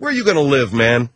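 normal talking voice

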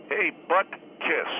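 slow, stretched-out teasing jeer; drawn-out taunt. Retold or imitated. Guest imitating kids teasing Butkus, a slow drawn-out jeer. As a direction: drag out the name to mock him. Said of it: "do you suppose someone said".

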